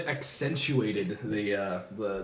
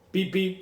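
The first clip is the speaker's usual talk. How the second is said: imitated car beep; 7 semitones higher than usual. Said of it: "it went".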